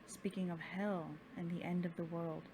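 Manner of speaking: ordinary delivery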